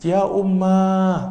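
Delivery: high, loud, slow lament; drawn-out keening call; mournful wail. Shown as a demonstration. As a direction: cry out for a mother in grief.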